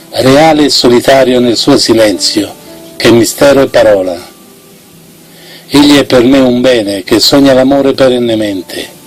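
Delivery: ordinary delivery, nothing marked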